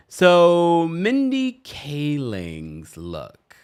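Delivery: slow, loud, stretched announcement; drawn out. Real, not acted. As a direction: stretch every word out. Announcing next look.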